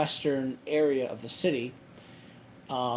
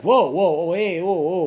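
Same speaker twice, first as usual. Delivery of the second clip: louder, startled retold whoas; alarmed protest; 4 semitones higher, 8 dB louder than usual. Man telling a threatening woman to back off, mock alarmed protest. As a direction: imitate him trying to halt her.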